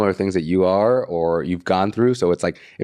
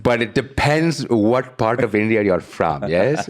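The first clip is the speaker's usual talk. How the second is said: a light put-on Indian lilt, slow; accent; slower than usual. Podcast host lightly trying an Indian accent, slow. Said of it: "I sound a little more like King Julian".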